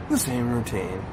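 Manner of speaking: low, soft, sighing resignation; acted deflated murmur; resigned partner. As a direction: sigh it out, quietly giving up. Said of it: "he'll come back to you and be like".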